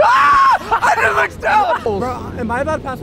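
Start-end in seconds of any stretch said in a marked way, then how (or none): 0.0-0.6 piercingly high scream; very high scream of panic; real terrified scream. Real, not acted. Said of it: "for screaming". 0.8-1.8 high, drawn-out panicked cry; slow, screamed, pitched way up; scared scream. Real, not acted. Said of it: "I was actually scared".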